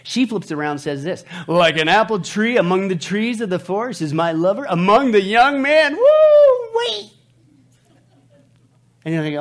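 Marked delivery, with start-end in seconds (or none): 1.5-5.9 loud, rising romantic reading; dramatic scripture reading. Acted. Reads scripture. 5.9-7.1 high, loud whoop; excited whoop. Real, not acted. Exclaims after romantic verse.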